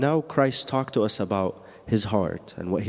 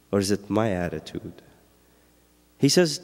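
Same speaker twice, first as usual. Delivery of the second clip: normal talking voice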